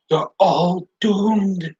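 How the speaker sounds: slow, drawn-out doom warning; slow ominous raspy drawl. Acted. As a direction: croak out the warning like Crazy Ralph. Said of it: "here comes Crazy Ralph".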